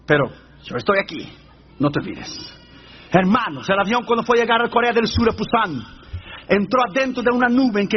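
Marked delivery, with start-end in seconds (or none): 0.1-2.6 low, quiet reassurance in reply. Acted. Answering a prayer about the shaking plane, low and quiet. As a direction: drop the voice low and answer calmly.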